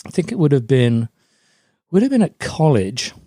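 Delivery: usual speaking voice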